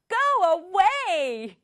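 high, drawn-out angry dismissal; mock angry shout. Teacher voices the sister answering her brother, mock angry shout. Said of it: "지율이가 화가 많이 났어요".

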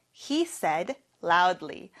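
very slow, every word clear; slow model sentence. Shown as a demonstration. Teacher showing students the sentence, very slow. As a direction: lay each word down for the class. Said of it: "같이 해볼게요".

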